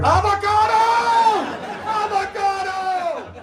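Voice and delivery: hammed-up child scream from another room. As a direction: yell it like a kid in the dentist chair. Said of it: "and I hear".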